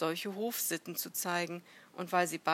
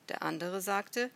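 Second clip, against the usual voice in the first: ordinary delivery, nothing marked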